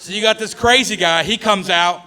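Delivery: ordinary talk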